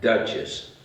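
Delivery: usual speaking voice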